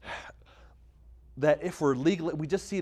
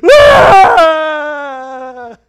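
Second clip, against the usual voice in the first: put-on high, loud toddler shriek; toddler scream imitation; 22 dB louder than usual. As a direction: shriek like a two-year-old who refuses. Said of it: "She runs to her room screaming".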